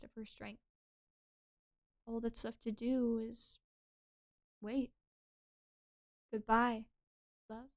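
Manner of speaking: soft, hushed tragic farewell; sad. Acted. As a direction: let it fade in grief. Tragic farewell scene read.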